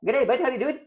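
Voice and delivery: high-pitched South African greeting; cartoonish South African, like a record spun backwards; South African accent. Shown as a demonstration. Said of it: "South African is the same, but spinning it backward".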